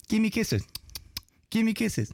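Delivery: chirpy little parrot chant; parrot impression. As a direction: mimic a pet bird begging for kisses. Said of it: "Just kept on".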